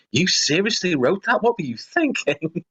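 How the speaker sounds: high-pitched mock disbelief at your own work; incredulous self-scolding. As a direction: scold yourself in lightly hammed-up disbelief.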